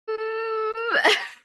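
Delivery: high, breathy burst of giggles. Real, not acted. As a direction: giggle helplessly. Laughs at the straw exercise.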